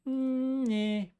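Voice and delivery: high teasing drawn-out hum; voice of the market. Pretend. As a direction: play the market smugly toying with you. Market personified mocking the trader.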